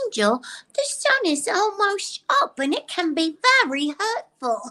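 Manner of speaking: Drusilla. Acted. Drusilla warning Angel about the sun, weird high voice. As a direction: play a weird, childlike vampire in a high voice. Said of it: "I love how weird she is".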